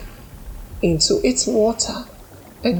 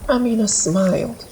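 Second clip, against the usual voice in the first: usual speaking voice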